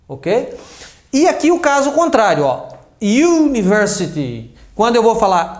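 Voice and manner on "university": "University" opening on a long "you" glide; consonant-like U. Shown as a demonstration. Teacher shows the opposite case, long Y-glide onset. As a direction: draw out the Y sound starting "University". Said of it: "começa com som de U".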